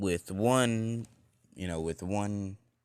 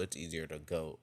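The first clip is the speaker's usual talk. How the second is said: plain reading voice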